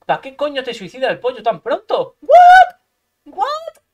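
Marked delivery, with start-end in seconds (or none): 2.2-3.8 very high-pitched shocked cry; incredulous squeal. Real, not acted. Streamer baffled by opponent's move, incredulous squeal. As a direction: squeal it in disbelief.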